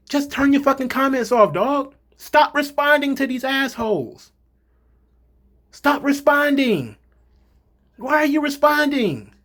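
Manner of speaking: exasperated pleading. Real, not acted. Pleading with wrestlers to stop.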